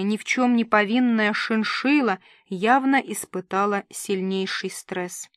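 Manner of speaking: ordinary delivery, nothing marked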